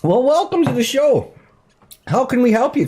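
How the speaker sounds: upbeat late-night radio host voice; bright, welcoming call-in host; talk show host. Acted.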